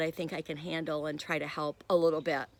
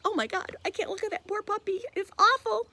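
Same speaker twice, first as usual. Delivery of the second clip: high, hammed-up squeal of pity over a puppy; mock distress; 14 semitones higher than usual. Teacher imitating her own reaction to a sad pet ad, high mock distress. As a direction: play it up like you can't bear to look, much higher. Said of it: "I get like".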